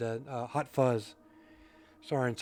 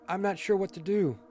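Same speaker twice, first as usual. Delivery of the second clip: higher-pitched character voice; game character voice; 8 semitones higher than usual. Acted.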